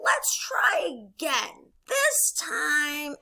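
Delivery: upbeat, slowed coaching Frog; Frog character voice. Acted. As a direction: cheer a friend on as Frog. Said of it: "back and forth with my voices".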